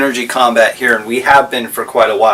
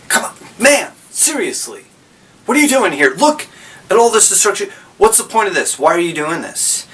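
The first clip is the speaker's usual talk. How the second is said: higher, earnest coaxing; pleading Golu; 6 semitones higher, faster than usual. Pretend. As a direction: talk him down, half-playfully. Golu reasoning with clone.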